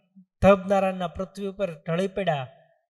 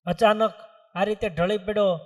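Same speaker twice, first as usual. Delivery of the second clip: normal talking voice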